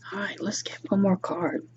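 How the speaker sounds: quick whispered note to herself; whispered aside. Real, not acted. Reader whispering an aside between cards. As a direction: drop to a whisper, half to herself.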